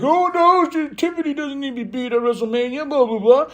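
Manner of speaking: high, mocking whine of complaining fans; lightly hammed-up fan complaints; mock whiny fans. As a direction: parody the moaners way up high. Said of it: "people are like".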